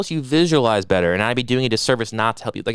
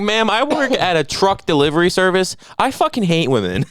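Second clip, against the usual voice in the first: lightly put-on, higher-pitched mover; mock sexist mover; 7 semitones higher than usual. Mover addressing mom on the phone, mock self-important. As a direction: imitate him declaring it, pitched up.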